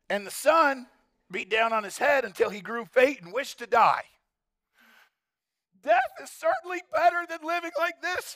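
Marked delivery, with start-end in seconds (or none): 5.8-8.4 high, whiny mock Jonah; whiny exclaim. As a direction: pitch it up like a sulking prophet. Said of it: "he exclaimed".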